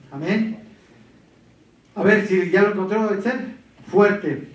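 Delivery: usual speaking voice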